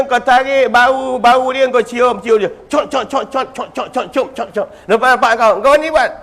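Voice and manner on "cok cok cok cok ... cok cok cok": soft smacking kiss noises; kissing sounds. Retold or imitated. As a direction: smack the lips in quick soft kisses. (bunyi ciuman yang lembut)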